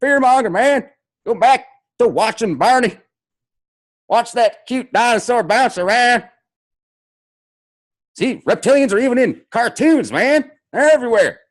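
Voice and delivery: high, loud mock stoner voice, man; a lazy surfer-dude parody, pitched high and loud. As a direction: ham up a spaced-out conspiracy guy. Mocking a fearmonger critic.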